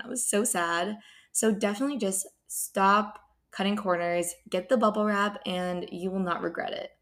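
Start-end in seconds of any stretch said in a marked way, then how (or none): none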